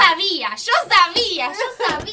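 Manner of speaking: loud, insistent imitated cry; mock yell. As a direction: shout it over and over, mocking him. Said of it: "Y te grita". (grito burlón e insistente)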